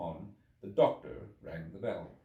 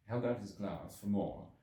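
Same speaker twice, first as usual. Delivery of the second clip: ordinary delivery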